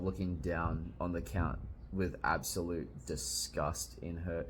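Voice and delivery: ordinary talk, nothing marked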